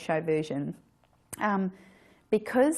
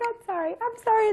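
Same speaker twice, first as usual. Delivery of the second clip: much higher, squeaky apologetic imitation; mock Dawn apologizing. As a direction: tease her for apologizing too much. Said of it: "And then you're like".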